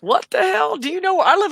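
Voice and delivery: high incredulous outburst. Real, not acted. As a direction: react in high disbelief. Indignant at suggestion.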